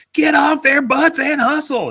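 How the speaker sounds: high-pitched, full-throated coach yell; screaming coach. Retold or imitated. Imitating a coach screaming at players who ignore him, high shout. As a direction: bellow like a coach riding his players. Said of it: "he's just screaming at the players".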